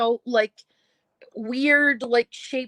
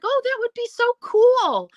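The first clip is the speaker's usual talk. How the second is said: delighted; 13 semitones higher, 4 dB louder than usual. Retold or imitated. Delighted reply.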